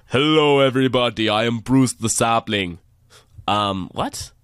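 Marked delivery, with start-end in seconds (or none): 0.1-2.8 sapling named Bruce in a hammed-up accent; silly exaggerated accent. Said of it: "who had a silly, exaggerated accent like that".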